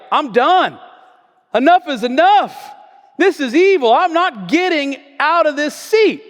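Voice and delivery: loud, high, furious refusal; angry defiance. Retold or imitated. Preacher acting Rosa Parks refusing to move, angry shout. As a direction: throw it back at them, fed up. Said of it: "Got a little angry".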